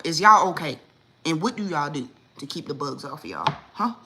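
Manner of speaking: ordinary talk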